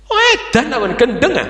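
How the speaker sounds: high-pitched, exasperated outburst; mock outrage. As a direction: blurt it out in hammed-up indignation. Acts an ordinary person's outraged reaction.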